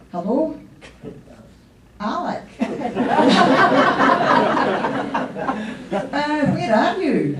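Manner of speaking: mock phone chat with a laughing lilt; pretend phone call. Speaking to late cousin Alec on a pretend phone, laughing. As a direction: play along with a fake call to heaven. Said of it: "there's a phone call here".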